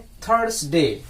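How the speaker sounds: थर्सडे drawn out slowly for the class; teacher slowly models थर्सडे; slow model word. Shown as a demonstration. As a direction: stretch थर्सडे so students catch it. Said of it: "थर्सडे बोलते हैं".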